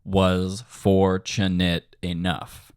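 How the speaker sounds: drawn-out, very slow lyric demo; recited in rhythm. Shown as a demonstration. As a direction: lay each syllable on the beat, slowly. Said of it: "let's go ahead and look at fortunate enough".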